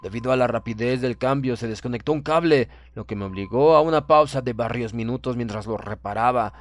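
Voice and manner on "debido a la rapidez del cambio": ordinary delivery, nothing marked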